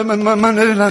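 high, stammering sleep talk. Acted. Father insisting who he is, stammering in his sleep. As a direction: stammer it out from inside a nightmare.

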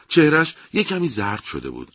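ordinary delivery, nothing marked

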